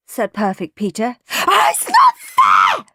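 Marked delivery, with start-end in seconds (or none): 1.5-2.9 very high, loud tantrum scream. A narrator's reading. Henry as his brother tears open the wrapping, screaming. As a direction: scream the injustice out slowly. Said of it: "screamed Horrid Henry".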